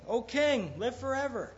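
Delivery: high, loud call from the den. Retold or imitated. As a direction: call up to the king from the pit. Voice answers from lions' den.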